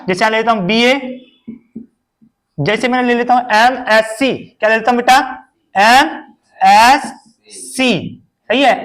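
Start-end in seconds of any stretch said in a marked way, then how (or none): 5.7-7.1 slow spelling out. Shown as a demonstration. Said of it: "drawn out for children".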